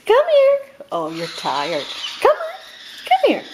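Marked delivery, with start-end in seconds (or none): 0.1-0.6 high, loud sing-song call to a bird. Real, not acted. Calling a bird to fly over. 2.2-3.4 high, loud sing-song call to a bird. Real, not acted. Calling a bird to fly over.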